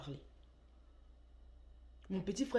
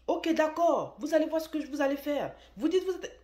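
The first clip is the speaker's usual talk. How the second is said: high, loud menacing shout; imitating furious husband; 10 semitones higher, 9 dB louder than usual. Husband warns the delivery brother, high loud threatening shout. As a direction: yell the warning like you're losing it. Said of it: "Et il commence à crier".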